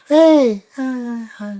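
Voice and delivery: high, loud gushing hello; excited fans. Retold or imitated. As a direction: squeal hi like a starstruck fan. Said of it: "They're like".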